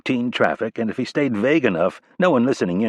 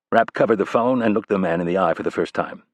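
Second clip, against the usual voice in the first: ordinary delivery, nothing marked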